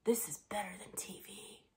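hushed aside to the person next to you; conspiratorial whisper during a meeting. Retold or imitated. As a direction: lean in and whisper it, unvoiced. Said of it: "lean over and whisper".

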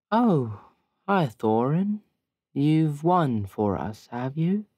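high, dreamy, half-present hello; dazed Bryn greeting. Acted. Bryn, dazed, greeting Thorin as he lifts her, high and dreamy. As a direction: sing the greeting like she's floating. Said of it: "is like".

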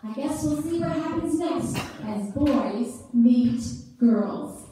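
ordinary talk, nothing marked

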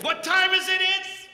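loud, high call to the crowd; rallying shout. Shown as a demonstration. Preacher shouting the cue to the congregation, rallying. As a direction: fire up the congregation. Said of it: "calling for crowd response".

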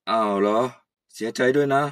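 put-on low, sorry old man; old uncle, sympathetic.

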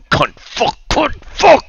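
sudden strangled rage, pitch shooting up; a gamer's furious yelp of swearing; rage outburst. Real, not acted. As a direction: lose it at the game, swearing. Getting killed in game, raging.

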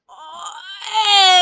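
very loud, high yelp; shocked cry. Real, not acted. Gamer yelping very loud as he gets hit.

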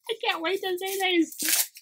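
real giddy, high-pitched eagerness; giddy anticipation. Real, not acted. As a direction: squeak it out, impatient with excitement. Eager for next surprise.

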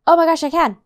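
thrilled. Real, not acted. Player finds the Pokemon is rideable, exclaiming thrilled. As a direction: burst out with delighted discovery. Discovers she can ride it.

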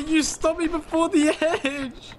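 high laugh-crying wail; crying with laughter. Real, not acted. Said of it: "I'm crying".

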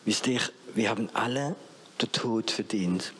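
normal talking voice

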